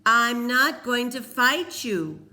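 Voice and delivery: slow, loud firm shout. A narrator's reading. Cole shouting his refusal at Peter, slow and loud. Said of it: "Cole shouted".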